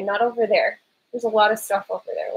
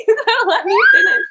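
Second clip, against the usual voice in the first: high, fast, laughing yell; laughing shout; 3 dB louder, faster than usual. Real, not acted. Mom laughing as her toddler knocks her computer, high laughing shout. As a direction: crack up while begging him to stop.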